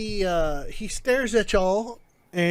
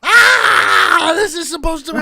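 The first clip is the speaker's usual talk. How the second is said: much higher and louder, hammed-up crying; mock wailing cry; 8 semitones higher, 11 dB louder than usual. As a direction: wail like a thwarted schemer. Character breaks down wailing.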